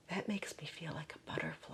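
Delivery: hushed unvoiced whisper; whispered quote. Quoting a young client's wonder, in a whisper. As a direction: whisper it like a secret. Retelling a young man's quiet remark.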